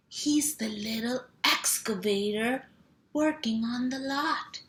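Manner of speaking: lively picture-book read-aloud with sound words; reading to children. Acted. Mom reading a truck book to kids, playful. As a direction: bounce the rhythm for little listeners.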